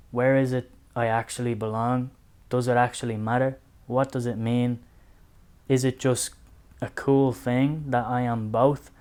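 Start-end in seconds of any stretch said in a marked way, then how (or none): none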